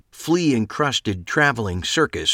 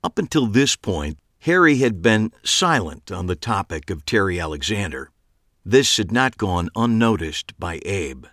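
ordinary delivery

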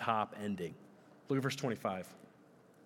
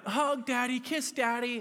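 put-on high-pitched pleading toddler; imitated small child; 9 semitones higher than usual. Toddler asking Daddy for bedtime affection, childlike pleading. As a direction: beg like a little kid at bedtime. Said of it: "they go through this every single night".

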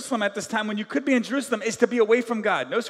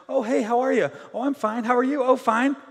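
higher, mock-friendly small talk; false pleasantries; 4 semitones higher than usual. Two people greeting while hiding troubles, falsely fine. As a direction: act out a breezy, fake "all is well" exchange. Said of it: "we buy into this false thing".

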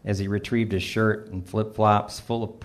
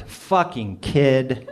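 slow, drawn-out mock outburst; mildly raised voice, dragging out each word; too-loud remark; 3 semitones higher, much slower than usual. A narrator's reading. Father cursing the boy who jumped in the pool, a bit too loudly. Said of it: "I might have said too loudly".

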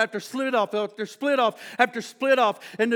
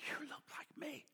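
whispered wonder; 5 semitones higher, 24 dB quieter than usual. Acted. Twins meeting in wonder.

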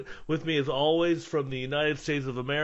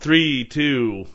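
usual speaking voice

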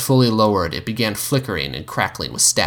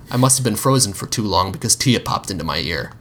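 usual speaking voice

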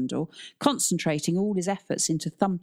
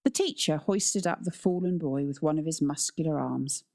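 plain reading voice

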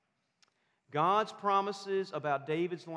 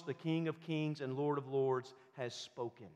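usual speaking voice

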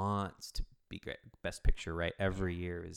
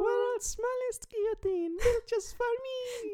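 tiny Marie Antoinette; 4 dB louder than usual. Pretend. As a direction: squeak it out like a doll-sized queen. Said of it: "Tiny Marie Antoinette".

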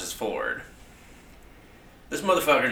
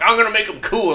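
mock eager writer; 12 semitones higher, 9 dB louder than usual. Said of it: "is just like".

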